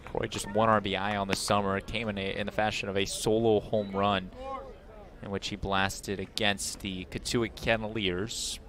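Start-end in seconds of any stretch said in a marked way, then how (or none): none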